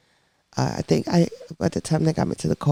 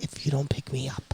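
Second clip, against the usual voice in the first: hushed, breathy aside under the breath; whispered retort; 10 dB quieter than usual. Retold or imitated. Wife whispering a retort to her hovering husband. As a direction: whisper it back through clenched annoyance.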